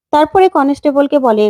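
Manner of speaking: ordinary delivery, nothing marked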